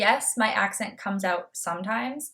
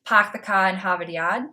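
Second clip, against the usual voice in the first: park, car, Harvard, Yard all r-less, "pahk the cah"; Boston accent; 3 dB louder than usual. Shown as a demonstration. Vlogger showing a popular Boston expression, Boston accent. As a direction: do the classic Boston dropped r's. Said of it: "another popular expression would be".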